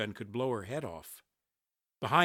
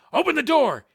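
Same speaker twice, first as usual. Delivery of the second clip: urgent high, loud yell; shout over shoulder; 10 dB louder than usual. A narrator's reading. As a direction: shout the order to someone behind you. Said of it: "he shouted over his shoulder".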